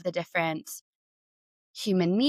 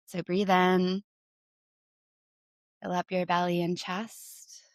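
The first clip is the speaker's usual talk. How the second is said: guided breathing. Acted. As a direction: ease them through each breath gently. Leads a breathing exercise.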